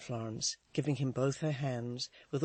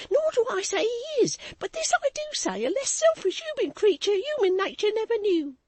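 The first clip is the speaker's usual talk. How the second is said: high, loud, rapid gushing; voluble maid; 8 dB louder than usual. A narrator's reading. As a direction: pour it out breathlessly. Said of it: "with her usual volubility".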